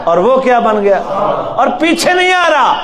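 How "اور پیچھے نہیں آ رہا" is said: higher, near-shouted exclamation; excited exclamation. Acted. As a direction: burst out with excitement at the drama. Preacher dramatizes Musa fleeing. (جوش سے بلند آواز میں)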